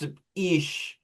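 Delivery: high-pitched, put-on Scottish accent; playful Scottish mimicry; mock Scottish accent. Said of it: "like, Scottish accent".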